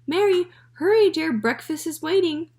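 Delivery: high, loud call upstairs; mother calling from downstairs. A narrator's reading. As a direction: call her down to breakfast. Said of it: "her mother called".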